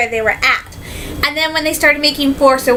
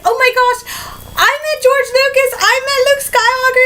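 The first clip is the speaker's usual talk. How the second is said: high-pitched starstruck gushing; gushing excitement; 10 semitones higher than usual. Retold or imitated. Crew member bragging about meeting idols, thrilled. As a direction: gush breathlessly about who you met.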